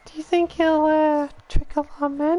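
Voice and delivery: put-on high falsetto girl voice; coy girly voice; man doing a woman's voice. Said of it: "my girl voice".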